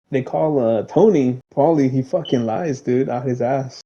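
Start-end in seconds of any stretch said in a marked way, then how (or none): none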